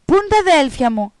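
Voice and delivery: high, loud mock cry; shouting along. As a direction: yell the line over the other boy. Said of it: "φώναξε και ο Αλσέστ".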